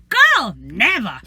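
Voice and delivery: slow sassy refusal. Real, not acted. Flat refusal to friend.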